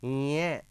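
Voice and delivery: ये as a held nasal nya; nasal pronunciation. Shown as a demonstration. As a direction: model ये as the palatal nasal, nya. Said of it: "क्या बोलेंगे जी?". (ये को नाक से "ञ" जैसा खींचकर बोलें)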